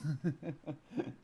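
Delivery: soft high-pitched giggle; a breathy rising laugh. Real, not acted. Laughing to viewers about the Joker in-joke.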